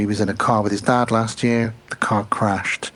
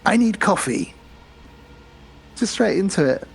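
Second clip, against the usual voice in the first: higher, deadpan demand; mock Russell Crowe; 6 semitones higher than usual. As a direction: bark it flatly like a tired priest.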